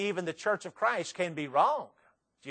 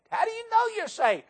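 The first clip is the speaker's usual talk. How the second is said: questioner. Acted. Acted exchange about salvation.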